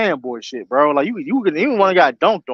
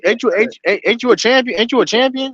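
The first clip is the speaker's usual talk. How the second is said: mocking coach taunt; 6 semitones higher than usual. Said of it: "I'll be that type of coach".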